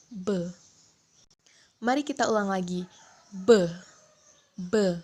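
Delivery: B with both lips pressed shut; voiced B sound. Shown as a demonstration. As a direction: say B as a sound, throat vibrating. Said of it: "akan terjadi getaran saat membaca huruf". (bunyi B dengan bibir tertaut dan tenggorokan bergetar)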